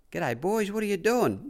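a high, chirpy put-on trainer's voice; mock-cheerful boss dropping by; imitating Bart, breezy. Retelling Bart's breezy greeting.

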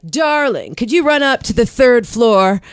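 high, loud, sugary society-lady voice; entitled widow. Retold or imitated. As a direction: ask the busy waiter for a favour like she owns him. Imitates cheap rich widow customer.

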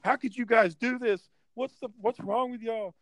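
high scolding voice; mock scolding women. Women from the house scolding the fighters, high-pitched. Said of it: "they're like".

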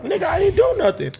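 imitated struggling protest, voice leaping up; high-pitched protest. Man pinned by police retelling his struggle, high protest.

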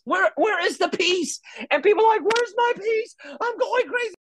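hammed-up desperate complainer; frantic mock whine. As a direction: squeal it like someone losing it. Mocking frantic people seeking peace.

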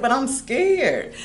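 slow, small, scared voice. Retold or imitated. Retold reply to cousin, slow and scared. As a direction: say it like a scared little kid. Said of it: "I said".

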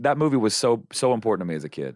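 ordinary talk, nothing marked

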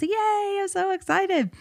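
high, gushing little cheer; cheering for myself. Real, not acted. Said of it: "how I just cheered for myself".

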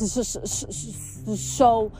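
stuck on the first hissed sound; stammer, starstruck, before the word comes; repeated stuttered s, then release. A narrator's reading. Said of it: "she stammered".

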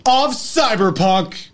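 hammed-up outro.

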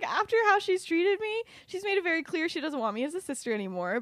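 high-pitched mock indignation, starting loud; indignant protest. Lily arguing with James about her sister, indignant. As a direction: protest high, easing off as it goes. Acted argument over sister's invitation.

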